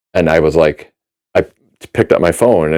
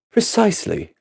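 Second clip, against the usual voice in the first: put-on British accent; 14 semitones higher, 6 dB quieter than usual. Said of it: "guy with a British accent".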